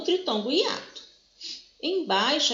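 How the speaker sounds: ordinary delivery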